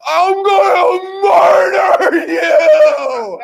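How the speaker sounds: long, high, drawn-out shriek; full-out scream. Retold or imitated. Buddy threatening the man from atop the raft, screamed. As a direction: stretch every word into a huge comic scream. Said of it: "screams it as loud as he can".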